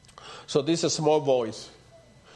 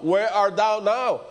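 slower, pressing on thou; emphatic question; 5 semitones higher, 4 dB louder, slower than usual. Retold or imitated. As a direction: lean into it to make him look at himself. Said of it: "Look at the condition you are now".

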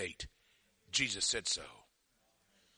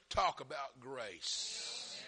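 softer, very drawn-out words; slow marvelling; 5 semitones lower, 7 dB quieter, much slower than usual. Real, not acted. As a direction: let "grace" stretch out in wonder. Marvelling after the murder story.

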